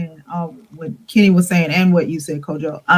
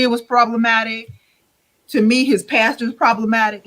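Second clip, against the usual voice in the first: ordinary talk, nothing marked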